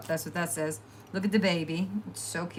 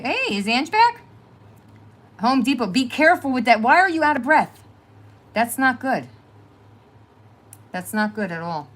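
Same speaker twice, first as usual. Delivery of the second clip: ordinary delivery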